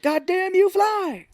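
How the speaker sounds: high, loud furious shout; mock screaming. Santa cursing the reindeer, high mock scream.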